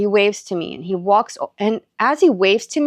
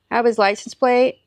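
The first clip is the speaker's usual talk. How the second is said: retold nonchalant stranger, matter-of-fact; offhand gum-chewer; 3 semitones higher than usual. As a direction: imitate a casual guy chewing gum. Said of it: "just, like, chewing his gum".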